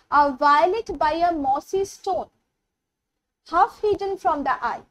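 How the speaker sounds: very slow, each word laid out; slow poem recitation. Shown as a demonstration. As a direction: recite the verse for the class. Teacher reciting poem lines.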